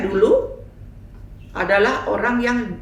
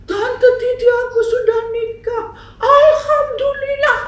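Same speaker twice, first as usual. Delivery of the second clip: joyful news; 8 dB louder than usual. Retold or imitated. Girl hugs her, announces marriage.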